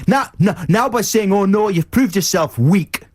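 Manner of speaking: high-pitched, hammed-up tycoon scolding; mock anger. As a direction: scold from way up high, then settle into the offer. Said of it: "You've made me angry".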